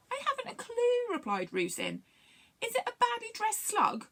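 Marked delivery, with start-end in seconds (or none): 0.1-1.1 high-pitched, clueless story character; Rusin's puzzled voice. A narrator's reading. Rusin replies to Longstint's question, puzzled. 2.6-4.1 high-pitched, hesitant guesser; a high character voice, offering a guess; Rusin's guessing voice. A narrator's reading. Rusin guesses what sluggy things hate, puzzled.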